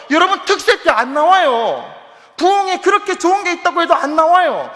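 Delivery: loud, emphatic, insistent preaching; preaching at full force. Real, not acted. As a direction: press every phrase home to the congregation. (힘주어 외치는 설교)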